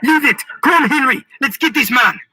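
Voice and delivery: loud, high, urgent shouting; screamed. A narrator's reading. Marquis to Henry starting the chase, screaming. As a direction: shout orders in a rush to go. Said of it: "Marquis screamed".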